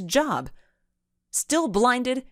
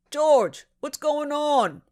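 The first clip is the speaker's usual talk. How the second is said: shouting; 9 semitones higher, slower than usual. A narrator's reading. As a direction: yell from the truck's passenger seat. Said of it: "shouted a squat man".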